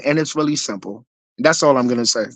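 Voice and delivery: ordinary delivery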